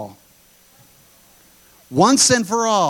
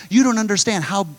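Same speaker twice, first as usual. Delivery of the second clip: usual speaking voice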